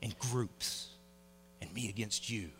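low, soft, earnest sermon voice; hushed preaching. Acted. Pastor urges the church to unite, soft earnest preaching. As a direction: draw the congregation in quietly.

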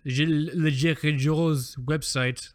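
a hammed-up French accent on the name; mock French, badly done. Host mangling the brand name in bad French. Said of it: "That's a really bad accent".

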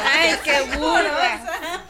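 laughs, then drawls the tease slowly; amused at her friend's slip, lets the laugh carry the words. Acted. Woman teasing her comadre for a blunder, laughing.

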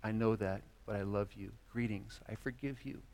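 Jesus forgiving the sorry believer, soft; gentle forgiveness. Acted. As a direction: forgive quietly and kindly. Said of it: "And he says".

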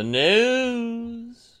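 slow, drawn-out anchor tease; news anchor. Pretend. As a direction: announce it like a TV hook. News bit starts.

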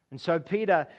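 ordinary talk

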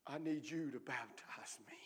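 soft, breathy pleading whisper; whispered prayer. Acted. Preacher leading the congregation in a plea to God, whispered. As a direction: pray it under your breath.

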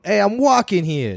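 high-pitched mock New York exclamation; New York accent. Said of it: "That's New York, or is that Boston?".

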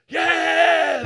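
high, loud whoop of joy; crowd's shout. Retold or imitated. Retelling the crowd cheering the baptism, a loud high whoop. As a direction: shout it out like the whole church cheering. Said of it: "They're all shouting".

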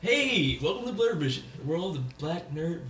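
drawn-out show-host welcome; auctioneer. Acted. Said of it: "stop trying to sound like the auctioneer".

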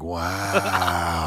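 imitating drowsy low mumble, drawn out; low, fading mock-sedated wow; passing out. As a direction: play someone drifting off under anesthesia. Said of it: "And then you passed out".

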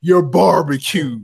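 high, loud, drawn-out imitation taunt; imitating a roaster's slow, loud put-down; mock roast punchline. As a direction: stretch out the mock insult.

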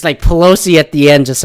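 usual speaking voice

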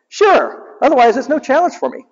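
high breezy hero, fast; high smug hedgehog hero; Sonic's voice. Retold or imitated. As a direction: toss it off like a cocky hedgehog. Sonic continues.

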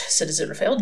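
ordinary delivery, nothing marked

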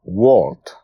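plain reading voice

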